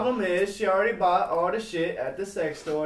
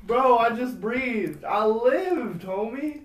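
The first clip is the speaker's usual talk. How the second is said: loud mock boast; 6 semitones higher, 6 dB louder, much slower than usual. Mock proud claim.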